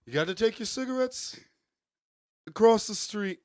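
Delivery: put-on high, nasal-free Brooklyn old man bark; Bernie Sanders impression. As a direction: channel a grumpy old Bernie. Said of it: "I don't know if I'm doing Larry David or Bernie".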